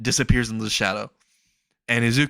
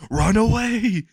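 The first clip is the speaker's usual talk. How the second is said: high, loud fleeing cry; calling out; 11 semitones higher, 4 dB louder, slower than usual. Acted. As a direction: shout it out as you bolt. Acted cry while fleeing.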